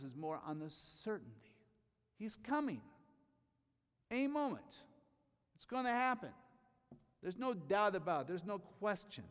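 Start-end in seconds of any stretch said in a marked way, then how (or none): 2.2-9.2 high, loud, ringing proclamation; full-force preaching. Acted. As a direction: preach it out to the whole room. Preacher insists the Lord comes.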